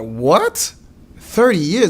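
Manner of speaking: high, loud disbelief; incredulous. Retold or imitated. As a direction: balk at it in shock, pitch jumping up. Retells disbelief at lawyer's offer.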